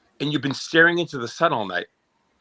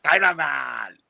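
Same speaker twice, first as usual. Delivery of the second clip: higher mock Chinese accent, laughing through it; 4 semitones higher, much slower than usual. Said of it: "you wanna do my Chinese?".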